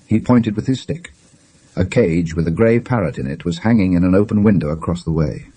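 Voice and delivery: ordinary delivery, nothing marked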